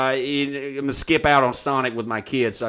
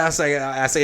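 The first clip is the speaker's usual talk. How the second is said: ordinary talk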